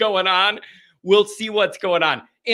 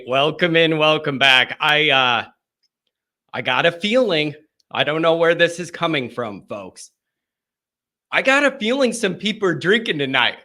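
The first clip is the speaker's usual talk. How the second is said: usual speaking voice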